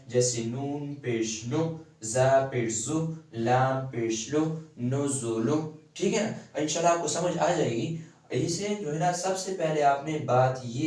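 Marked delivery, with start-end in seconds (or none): 0.1-5.7 slow drill with "نو", "زو", "لو" each kept short; short pesh vowels. Shown as a demonstration. Teacher showing students the short pesh. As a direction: sound out "نون پیش نو" slowly, the "نو" cut off quickly. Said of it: "اسے چھوٹا پڑھنا ہے".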